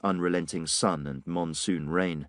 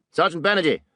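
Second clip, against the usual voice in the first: higher calling shout across the alley; 8 semitones higher, 4 dB louder, faster than usual. Acted. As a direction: call the sergeant over from a distance. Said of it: "Digby shouted".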